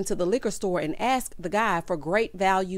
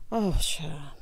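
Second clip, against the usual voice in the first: ordinary talk, nothing marked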